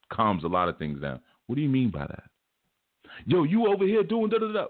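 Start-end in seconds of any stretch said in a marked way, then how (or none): 1.5-2.2 pointed challenge. Retold or imitated. Challenging question to a ranter. 3.3-4.7 high, mocking imitation of a rant; mocking the ranter. Mocking a ranting stranger, high-pitched.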